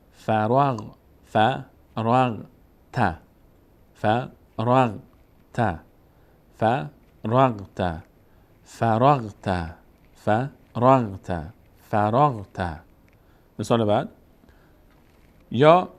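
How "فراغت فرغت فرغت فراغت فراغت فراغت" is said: فراغت read very slowly, each sound held; slow syllable demo. Shown as a demonstration. Teacher slowly models فراغت for beginners. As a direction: draw out فراغت for the class. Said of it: "ر باید بچسبه به غین".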